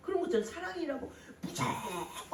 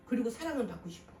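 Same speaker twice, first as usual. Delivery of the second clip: ordinary delivery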